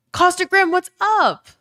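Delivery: high, loud shout-out; excited greeting. Real, not acted. Streamer hails a viewer arriving in chat, excited. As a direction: call the name out high and loud.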